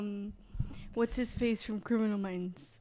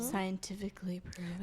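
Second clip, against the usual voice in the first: whisper; 6 semitones lower, 7 dB quieter than usual. Acted. Spooky whispered question about fairies.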